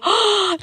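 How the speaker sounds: high shocked intake of breath; sharp gasp. Retold or imitated. Girlfriend overhearing the lie, sharp gasp. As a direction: gasp at the betrayal. Hearing boyfriend is with a girl.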